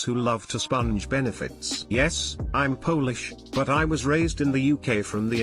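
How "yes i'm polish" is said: ordinary delivery, nothing marked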